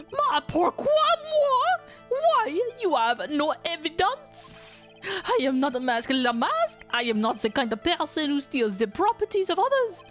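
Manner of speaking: high, indignant French chef voice; French accent. Acted. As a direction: protest in a put-on French accent. French chef denies theft.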